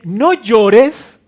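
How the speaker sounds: "No llores" called out high and loud; Jesus' words proclaimed loudly as a promise; promising comfort. Retold or imitated. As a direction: declare "No llores" as a firm promise. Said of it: "no es porque Él sea insensible".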